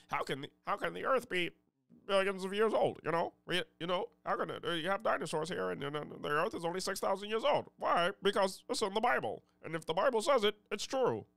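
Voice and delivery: mock the smug creationist, hammed-up. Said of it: "I just did a good [name] impression".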